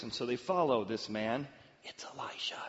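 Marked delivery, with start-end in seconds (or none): 1.8-2.6 hushed, conspiratorial whisper; whispered aside. Shown as a demonstration. Preacher revealing the helpful voice's identity, whispered. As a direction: whisper the secret to the audience.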